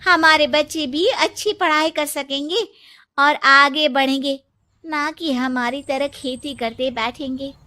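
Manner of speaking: ordinary talk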